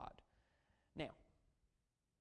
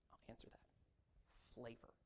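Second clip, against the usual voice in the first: whispered aside; 6 semitones lower, 15 dB quieter, slower than usual. Real, not acted. Answering own question aside.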